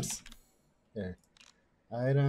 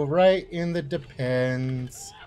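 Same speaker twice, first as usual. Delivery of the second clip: slow, stretched "Right in the depends"; drawn-out taunt; 2 semitones lower, slower than usual. Real, not acted. Player taunting a shot enemy, drawn-out taunt. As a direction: drag out "depends" with relish.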